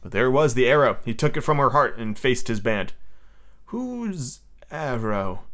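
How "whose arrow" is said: very slow, softer demand; stern leader. A narrator's reading. Peter facing his band with the arrow, sternly. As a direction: draw the question out ominously.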